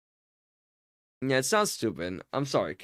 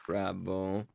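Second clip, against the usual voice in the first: usual speaking voice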